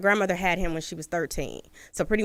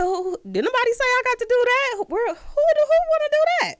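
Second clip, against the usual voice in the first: squeaky high, panicked protest; flustered and caught off guard; shocked disbelief. Retold or imitated. Told she must view the body, high panicked disbelief.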